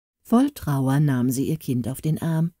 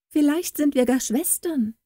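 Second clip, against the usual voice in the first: teasing; 9 semitones higher than usual. A narrator's reading. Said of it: "meinte neckisch".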